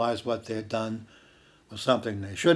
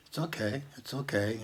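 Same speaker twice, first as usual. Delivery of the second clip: soothing; 9 dB quieter than usual. Retold or imitated. Soothing a bitten, hurt dog.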